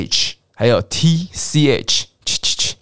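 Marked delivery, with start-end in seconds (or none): 2.2-2.8 "七七七" as unvoiced ch-ch-ch puffs; whispery "七七七", consonant only, no vowel; voiceless CH. Shown as a demonstration. Teacher reviews CH for learners, voiceless "七七七".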